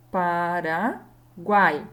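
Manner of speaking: very slow "Paraguai" as Pa, ra, guai; syllable by syllable. Shown as a demonstration. Teacher dividing Paraguai, syllable by syllable. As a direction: pause between syllables, keeping "guai" whole. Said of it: "na hora de separar".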